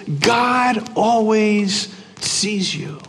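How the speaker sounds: very slow, hushed and deliberate; slow emphasis. Real, not acted. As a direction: let each word land slowly. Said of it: "The point is this".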